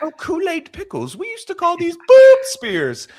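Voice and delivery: very high, hammed-up British lady; British baking woman. Podcaster imagining the baking woman tasting pickles, high mock British voice.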